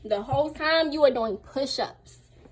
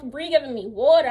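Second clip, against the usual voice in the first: normal talking voice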